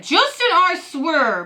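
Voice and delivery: high, loud, slow threatening yell; furious shout. Acted. Father losing his temper at his son, furious shout. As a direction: bellow the name like a warning.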